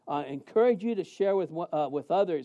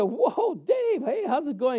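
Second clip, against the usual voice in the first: much higher, excited Whoa Dave; high surprised hello to an old friend; surprised greeting; 7 semitones higher, slower than usual. Retold or imitated. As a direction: pitch way up in happy surprise. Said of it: "And I said".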